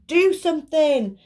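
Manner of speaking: high, loud shouted demand. Acted. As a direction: shout it at them from underneath. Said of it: "shouted Buster".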